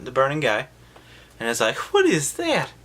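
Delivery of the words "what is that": high, loud disgusted gasp; mock startled. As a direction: recoil at the creature. Michael sees ugly corpse.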